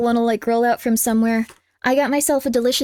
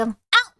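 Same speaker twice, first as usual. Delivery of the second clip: pained yelp; 6 semitones higher than usual. Real, not acted. Cat hits jaw.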